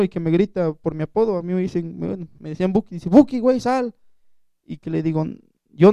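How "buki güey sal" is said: calling out a nickname, louder and higher; mock yell to someone hiding; a hint of shouting across the street; retold shout. Friend calling him out by nickname.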